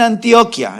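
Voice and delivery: Antioquia stressed on the o; rejected pronunciation. Shown as a demonstration. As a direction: say Antioquia as an-TIO-kya. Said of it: "pero no es Antioquia".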